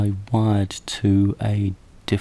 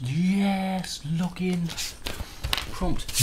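much higher triumphant yes; excited; 10 semitones higher, much slower than usual. Real, not acted. Login prompt shows up, he cheers to viewers. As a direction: celebrate the small victory.

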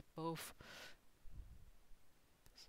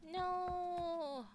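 drawn-out no; 11 semitones higher than usual. Real, not acted. Player's drawn-out cry at game.

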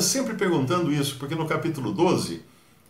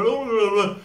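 mock tongues babble; 8 semitones higher than usual. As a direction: imitate someone tripping over their tongue. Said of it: "o outro dá nó na língua".

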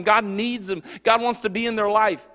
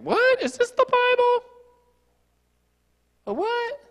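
much higher, incredulous squeak; shocked disbelief. Pretend. A shocked reader reacting to Hosea's story, very high and incredulous.

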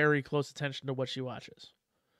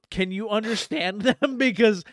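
amused mock plea; 4 semitones higher, 4 dB louder than usual. Father voices amused questioning of daughter.

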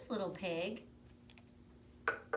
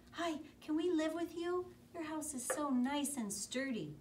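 much higher, sweet pleading pig voices; high little pigs asking; 11 semitones higher, 4 dB louder than usual. Acted. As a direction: ask nicely like little piggies. Brothers ask to stay.

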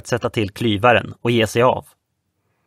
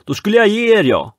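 loud, high threat; threatening; 9 semitones higher, 4 dB louder than usual. Acted. Man on the yacht threatening the boys. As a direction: hurl a threat you can't back up. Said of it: "hotade han".

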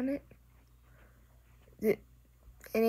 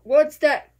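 sudden loud what's that; loud startled what's that; startled question; 11 dB louder than usual. Real, not acted. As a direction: blurt it out in surprise. Startled by something on screen.

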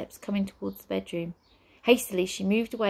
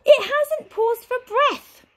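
much higher, louder, slow wail; despairing wail; 10 dB louder, slower than usual. Acted. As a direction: drag it out in despair. Flabbergast wailing.

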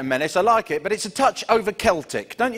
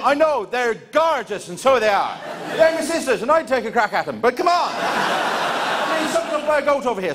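high, laughing pleading Irish lad; Irish accent; 8 semitones higher than usual. Retold or imitated. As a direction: beg for attention like a put-out Irish brother. Irish singer brother pleads.

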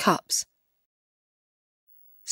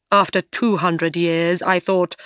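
ordinary delivery

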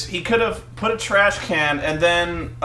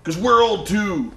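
higher-pitched, declaiming wrestler promo; Hulk Hogan; 5 semitones higher than usual. Retold or imitated. Host mimics a wrestler handing the ring over, hyped promo impression. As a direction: proclaim it as a pumped-up wrestler. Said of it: "and he's like".

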